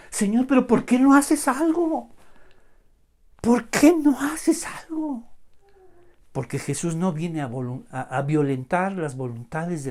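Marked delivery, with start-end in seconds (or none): 0.1-2.1 high, loud pleading complaint; imitating an impatient believer; acted protest. Voices believer protesting to the Lord. 3.4-5.2 high, slow acted plea; imitating exasperation, slowly and pitched up; drawn-out protest. Repeats the protest, stretched out.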